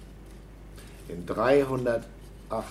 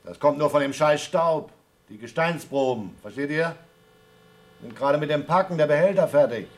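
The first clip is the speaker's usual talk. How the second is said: loud over the radio; 5 semitones higher, 5 dB louder than usual. Acted. Talking loudly over radio link.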